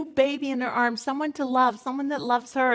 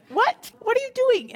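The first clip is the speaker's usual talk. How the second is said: startled protest; 12 semitones higher than usual. Retold or imitated. Wife startled as husband turns off movie, high protest. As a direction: jump up in pitch, caught off guard. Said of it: "and I'm like".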